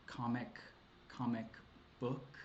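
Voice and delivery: COMIC said twice, separated by pauses, then BOOK; soft, halting "comic... comic... book"; halting, odd delivery. Real, not acted. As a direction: hesitate between each word of "comic comic book", softly. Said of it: "don't know why I said it like that".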